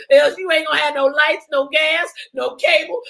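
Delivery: ordinary delivery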